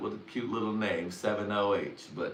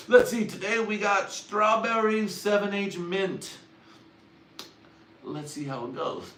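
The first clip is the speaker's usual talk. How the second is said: mock product reviewer; 11 semitones higher, 4 dB louder than usual. A reviewer tasting gas station heroin, higher and chirpy. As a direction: ham up a cheery taste-tester. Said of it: "motherfuckers reviewing this shit like".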